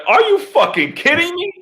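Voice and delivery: very high, disbelieving squawk; exaggerated outrage, pitch shooting way up; hammed-up disbelief; retold incredulous outburst. Outraged reaction to being accused.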